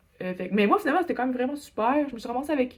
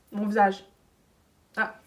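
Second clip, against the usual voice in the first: ordinary talk, nothing marked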